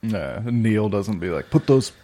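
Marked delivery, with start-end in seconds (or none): 1.5-1.9 put-on a half-hearted Scottish accent, raised a touch; a clumsy attempt at a Scottish accent; mock Scottish accent. Friend mocking Neil in a weak Scottish accent. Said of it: "I was trying to do a Scottish accent".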